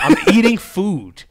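drawn-out, mock-indignant outburst; retold angry rant. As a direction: act out an irate man, slowly stressing each word. Retelling an angry neighbour's rant.

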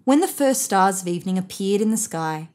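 ordinary delivery, nothing marked

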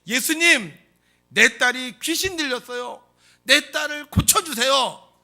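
loud, high, drawn-out plea; 큰 소리로 외침. Acted. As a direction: shout it so he hears as he passes. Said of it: "큰 소리로 외쳤습니다". (큰 소리로 애타게 외치기)

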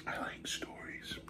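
spooky whisper; dark whisper behind you. Acted. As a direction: whisper it from the shadows.

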